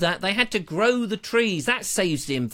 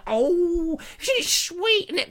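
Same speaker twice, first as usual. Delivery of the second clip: gushing tourist; 10 semitones higher, slower than usual. Retold or imitated.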